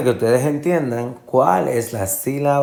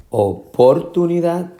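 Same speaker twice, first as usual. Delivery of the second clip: oportunidad with POR punched high; misplaced second stress; 7 semitones higher than usual. Shown as a demonstration. Teacher asking which is right, o-POR-tunidad. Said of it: "la fuerza de la pronunciación".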